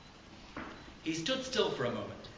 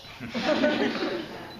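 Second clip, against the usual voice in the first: laughing; 11 semitones higher, 5 dB louder than usual. Real, not acted. Laughing at the goggles.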